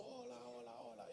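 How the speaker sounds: soft distant echo fading away; faraway echo. Retold or imitated. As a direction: let it trail off far away. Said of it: "le gritó".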